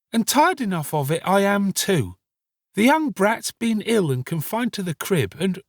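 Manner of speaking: put-on high-pitched young woman, tired of it; weary Nancy grumbling. Story figure Nancy complains.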